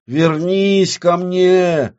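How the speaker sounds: high, very slow drawn-out plea; crying out. Acted. Оксана begs her world to return, crying out. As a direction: stretch the cry out in desperation. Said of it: "закричала Оксана".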